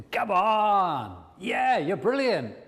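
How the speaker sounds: loud, high rousing cheer; cheering champion. Acted. Coach models the inner champion, cheering loudly. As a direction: cheer them on at full voice. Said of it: "That voice should be your champion".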